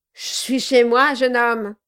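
loud, gruff dwarf. A narrator's reading. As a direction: bark it like a surly dwarf. The dwarf's voiced lines.

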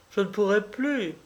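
sobbing. A narrator's reading. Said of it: "en sanglotant".

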